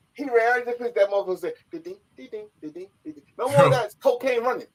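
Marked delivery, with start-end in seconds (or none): 1.7-3.2 soft, high repeated dings; imitated bell dinging. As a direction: mimic a little bell under your breath.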